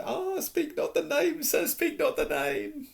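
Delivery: very high, slow pleading elf voice; terrified house elf. Acted. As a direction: beg him never to say it. Dobby covering his ears.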